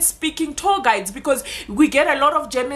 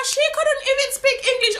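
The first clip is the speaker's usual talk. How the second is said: mocking critic; 11 semitones higher, faster than usual. Mocking critics of her English.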